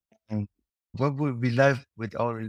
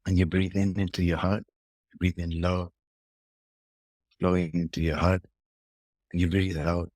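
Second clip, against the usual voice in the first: guided meditation. Shown as a demonstration. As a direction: guide the listener gently into the heart. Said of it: "like a little meditation to finish with".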